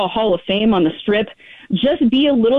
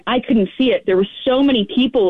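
usual speaking voice

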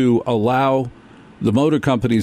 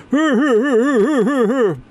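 high laugh; 13 semitones higher than usual. Real, not acted. Laugh after joke.

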